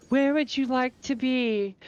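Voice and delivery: high, slow, saccharine mock-official send-off; Elder Seedseer voice. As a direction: play the Seedseer politely shooing you out. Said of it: "you're like".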